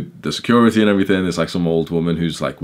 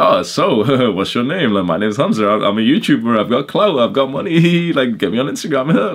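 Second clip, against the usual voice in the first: hammed-up bragging YouTuber, pitched up; mock show-off; 7 semitones higher, 5 dB louder than usual. Pretending what he could have told the girls, mock bragging. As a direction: brag in a mock voice about clout and money.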